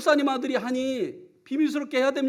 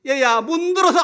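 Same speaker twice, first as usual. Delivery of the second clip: grandma's order, laughing; 5 semitones higher, 10 dB louder than usual. Retold or imitated. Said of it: "할머니 학교 다녀왔습니다".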